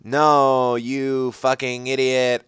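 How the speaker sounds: slow, drawn-out insult; lightly voiced angry girl; mock shout. A narrator's reading. Ebony shouting at Draco, slow mock anger. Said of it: "I shouted".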